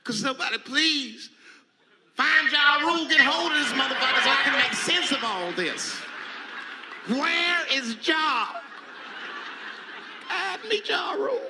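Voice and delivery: building to a loud, high wail; rising from slow pleading to a loud high shout; mock desperate plea. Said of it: "I'll be in the crib like".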